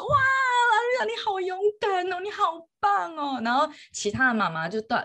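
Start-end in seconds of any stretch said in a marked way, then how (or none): 0.0-3.4 put-on gushing over a brave little girl, voice choked; emotional cheering. Mother cheering daughter after game.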